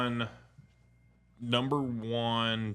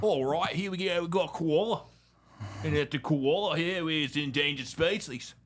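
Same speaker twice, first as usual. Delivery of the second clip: higher, louder mock Australian host; Australian accent; 8 semitones higher, 4 dB louder than usual. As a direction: present a koala like a wildlife show. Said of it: "Steve Irwin's kids".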